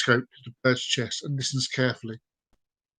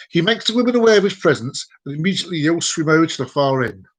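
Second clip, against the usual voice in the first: ordinary talk, nothing marked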